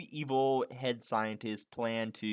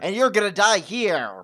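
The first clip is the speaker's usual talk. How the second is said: loud, higher evil villain gloat; mad scientist; 5 semitones higher, 11 dB louder than usual. Retold or imitated. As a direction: gloat like a cackling movie villain. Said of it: "he's like".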